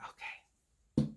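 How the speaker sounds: soft breathy whisper, no voice. Real, not acted. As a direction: whisper it like you're sneaking. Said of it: "Don't know why I'm whispering".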